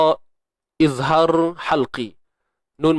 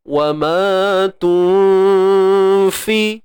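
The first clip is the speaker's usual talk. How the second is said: Quran recitation; 3 semitones higher, 4 dB louder than usual. Shown as a demonstration. Teacher reciting the verse as a tajwid demo, chanted. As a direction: recite with a hidden nun before fa. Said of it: "itu mudah je".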